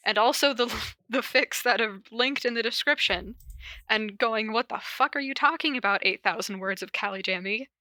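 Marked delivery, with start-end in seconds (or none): none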